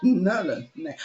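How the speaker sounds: real laughter breaking into the words; laughing. Real, not acted. Storyteller forgot the character's name, laughing at herself. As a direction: talk fast and loud through giggles. Amused at forgetting the made-up name.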